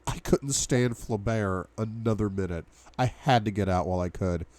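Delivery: plain reading voice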